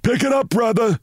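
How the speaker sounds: high, sharp cop's order; snapping cop. A narrator's reading. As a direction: snap it out high like an impatient cop. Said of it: "snapped a cop".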